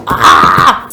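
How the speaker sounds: a short, loud, low mock yell of frustration; frustrated yell at the page. Reader fed up with slow characters, mock yell. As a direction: blurt out an exasperated ah. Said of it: "I'm, like, screaming at the page".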